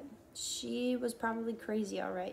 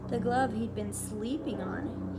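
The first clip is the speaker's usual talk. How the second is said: plain reading voice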